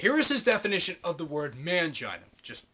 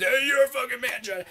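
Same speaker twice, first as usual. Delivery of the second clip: higher, put-on heckler voice; mocking commenter voice; 6 semitones higher, 4 dB louder than usual. Vlogger mimicking thumbs-down commenters, mocking put-on voice. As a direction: imitate a dumb hostile commenter.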